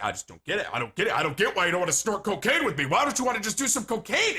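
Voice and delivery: building from baffled to high, loud protest; agitated. Retold or imitated. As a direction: act out a pushy guy getting worked up. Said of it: "starts to get agitated".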